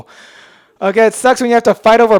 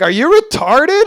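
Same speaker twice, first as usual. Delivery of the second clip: incredulous mom; 9 semitones higher, 5 dB louder than usual. Retold or imitated. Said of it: "my mom was just like".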